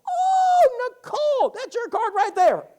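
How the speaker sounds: put-on high, loud, delighted old woman; excited old lady. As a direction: squeal it out like a thrilled grandma. Voicing Bettina pointing at card.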